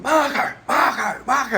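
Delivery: shouted calls across the park; high yelling Marco Marco Marco; calling out. Retold or imitated. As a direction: call loud and high for a lost dog. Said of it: "one's going".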